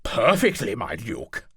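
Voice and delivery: gleeful, loud eager reply; eager knight answering with relish; pure joy. Acted. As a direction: savour the permission to kill. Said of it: "he could hear pure joy echo in his words".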